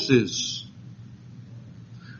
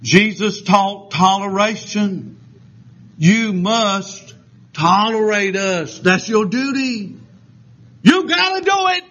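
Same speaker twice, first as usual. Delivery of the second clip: slow, insistent demand climbing to a high-pitched finish; mock demanding activist; 4 semitones higher, slower than usual. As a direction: mimic pushy people, drawing it out and pitching up. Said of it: "At first they say".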